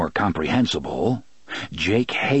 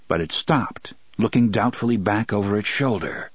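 plain reading voice

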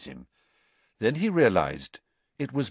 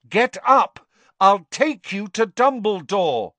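much higher and louder, slow and clear; 10 semitones higher, 7 dB louder than usual. Acted. Harry urges the fallen Crouch to stand, loud and clear. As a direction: say it loud so a dazed man hears. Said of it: "said Harry loudly and clearly".

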